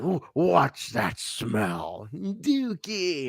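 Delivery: very slow, drawn-out character voice; movie scene imitation. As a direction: drag out each word, rising high at the end. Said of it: "reminded me of that scene from".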